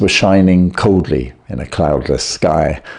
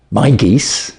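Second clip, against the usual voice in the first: a touch of surprise; puzzled question, lightly voiced; mild surprised echo; surprised landlord; 4 semitones higher than usual. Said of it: "The man seemed surprised".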